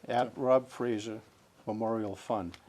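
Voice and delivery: slow, loud and carefully spelled out; each word spoken deliberately and clearly; over enunciating. Shown as a demonstration. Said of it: "say it one more time slowly".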